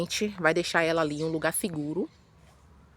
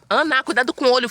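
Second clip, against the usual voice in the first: loud, higher warning call; 5 semitones higher, 7 dB louder than usual. Real, not acted. Mother warns daughter Ana about branches, calling out loud. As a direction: warn her daughter sharply.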